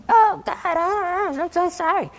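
very high, drawn-out mimicry; whiny singsong mockery of an apology; mocking voice. Evil voice mimicking a penitent's prayer, mocking. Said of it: "I heard a voice mocking me".

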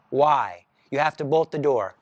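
ordinary delivery

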